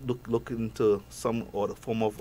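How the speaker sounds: ordinary delivery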